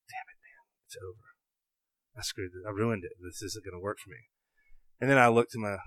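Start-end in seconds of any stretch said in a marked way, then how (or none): 0.1-4.3 despairing whisper. Retold or imitated. Retold despair, nearly whispered.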